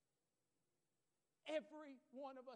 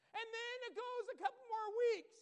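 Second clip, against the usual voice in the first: ordinary delivery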